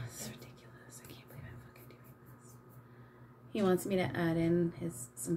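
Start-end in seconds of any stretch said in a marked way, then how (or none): 0.1-2.5 whispered to yourself, mortified. Real, not acted. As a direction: hiss it out under your breath. Mortified aside to herself.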